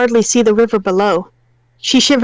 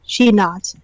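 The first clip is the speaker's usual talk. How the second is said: plain reading voice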